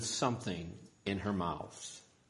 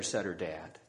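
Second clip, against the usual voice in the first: ordinary delivery, nothing marked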